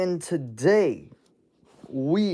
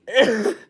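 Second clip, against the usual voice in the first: real laugh; 9 semitones higher, 4 dB louder than usual. Real, not acted. Reacting to a funny video, bursts out laughing.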